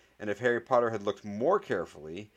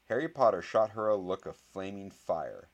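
ordinary delivery, nothing marked

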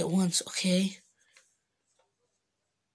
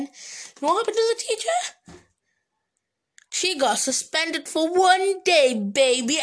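higher and louder, savouring the payoff; gleeful triumph; 8 semitones higher, 5 dB louder than usual. Real, not acted. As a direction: crow about the teacher's comeuppance.

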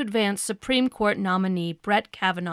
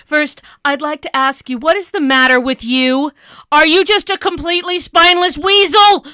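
louder, higher, furious accusation; angry outburst; 5 semitones higher, 8 dB louder than usual. Acted. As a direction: blow up at the guest. Interviewer bursts out at senator.

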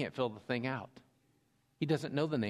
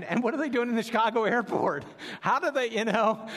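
higher and louder, mock bewildered; mock baffled surprise; 9 semitones higher, 7 dB louder than usual. Pastor retelling Rich's confusion at the stranger, mock baffled. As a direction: act out a puzzled double take, hammed up.

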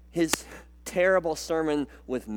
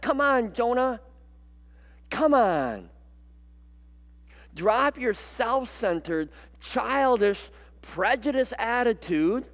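rising, louder and higher exhortation; God pleading with Jonah; 6 semitones higher, 4 dB louder than usual. Acted. God urging the pouting prophet to care for Nineveh, impassioned plea. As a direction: preach it as God shaking Jonah awake. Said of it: "God looks at Jonah and he says".

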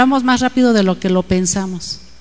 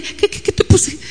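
high, breathy, baffled stammer; startled; 9 semitones higher than usual. Retold or imitated. Mother caught off guard by her child, high startled whisper.

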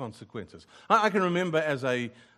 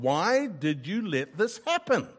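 re-enacted protest to God, raised pitch; mildly acted cry of reproach; mild retold shout; 5 semitones higher than usual. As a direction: plead upward in a half-shouted complaint. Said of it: "shouting at God".

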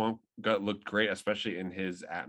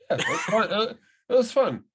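excited; 10 semitones higher, 6 dB louder than usual. Real, not acted. Excited overlapping reaction.

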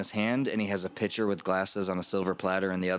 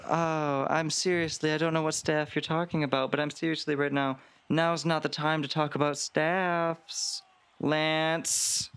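higher, evasive put-on voice; flustered Veronica; 7 semitones higher than usual. Veronica dodging Lance's question about the staff, higher and flustered. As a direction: brush him off while the servants watch. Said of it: "She goes".

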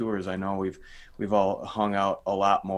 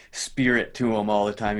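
ordinary delivery

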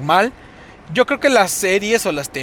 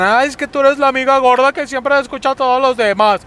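high, louder sneering put-on voice; mocking quote; 9 semitones higher, 4 dB louder than usual. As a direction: parrot the critics in a whiny high voice. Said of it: "muchos dicen".

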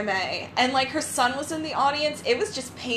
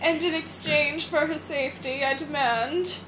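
evil villain; 4 semitones higher than usual. Pretend. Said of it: "the evil villain".

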